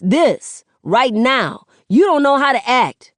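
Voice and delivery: angry shout. Acted. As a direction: yell it at her, fed up. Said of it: "Seven shouts".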